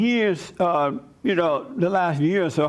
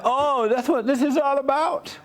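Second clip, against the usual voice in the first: sarcastic realization; 9 semitones higher than usual. Retold or imitated. Realizes the real reason.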